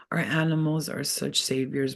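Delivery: ordinary talk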